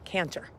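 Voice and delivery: "cantor" with a clear T in the middle; quiet, corrective "cantor", T not S. Shown as a demonstration. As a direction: say "cantor" softly, sounding the T. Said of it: "Cantor, not cancer".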